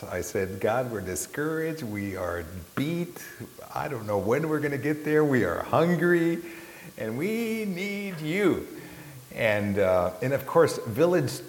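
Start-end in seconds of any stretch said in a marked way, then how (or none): 0.6-8.7 high, strained pleading to God; pleading prayer. Retold or imitated. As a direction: beg God for help, voice climbing. Retold desperate prayer to God.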